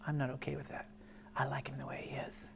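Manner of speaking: very soft, near whisper; hushed sincerity. Real, not acted. Father protecting his son's nature, hushed and firm. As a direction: drop to an earnest hush, low and quiet.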